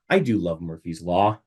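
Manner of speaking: ordinary talk, nothing marked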